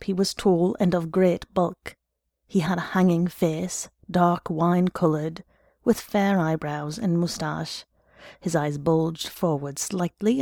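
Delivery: usual speaking voice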